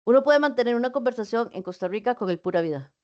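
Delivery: ordinary delivery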